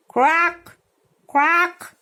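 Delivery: high loud cawing; crow call. Shown as a demonstration. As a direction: caw like a crow. Imitating crow calls.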